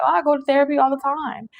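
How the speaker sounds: high, fast mock-breezy reply; dismissive brush-off. Imitating a viewer who dodges the point, breezy brush-off. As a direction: toss it off like it's nothing.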